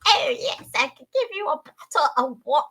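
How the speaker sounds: high, hammed-up mock-English merchant; comedic merchant voice. Game merchant offers a customer water, comedic English merchant voice. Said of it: "a merchant at this point".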